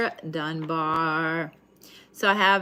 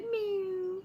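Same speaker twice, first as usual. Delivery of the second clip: cat meow imitation; 13 semitones higher, 9 dB quieter than usual. Mimicking cat crying at bed.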